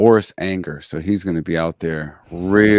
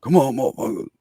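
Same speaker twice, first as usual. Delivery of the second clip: deep yell; 7 semitones higher, faster than usual. Retold or imitated. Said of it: "I build the bass up a little bit".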